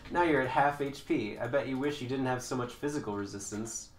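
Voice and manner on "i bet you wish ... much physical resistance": usual speaking voice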